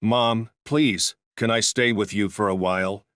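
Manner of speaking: ordinary delivery, nothing marked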